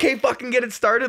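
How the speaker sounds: high, eager imitated rallying call; urging on. Wave starter to people down the line, eager urging. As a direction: push the crowd to join in.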